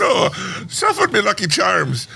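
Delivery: high, hammed-up Irish brogue; a jokey stage Irishman greeting the Yanks; Irish accent. Greeting American hosts in a hammed-up Irish accent. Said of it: "I'm doing an Irish accent".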